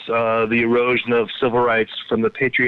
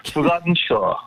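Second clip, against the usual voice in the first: put-on English accent echo; 6 semitones higher than usual. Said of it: "That's correct".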